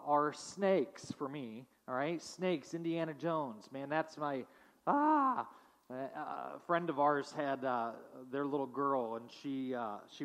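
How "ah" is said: mock scream. Mock scream about snakes.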